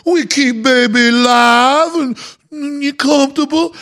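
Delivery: high, slow mock Cajun accent. Oil executive overrules the engineers, in a high slow Cajun drawl. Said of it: "he's got this coon-ass accent".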